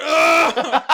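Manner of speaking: loud, high mock groan of impact; pained cry. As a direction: yelp like you've been flattened by a tackle. Acting out being tackled, dying.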